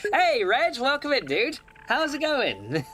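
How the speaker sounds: bright, high call of welcome; excited greeting. Real, not acted. Streamer greets a returning viewer with an excited high hello.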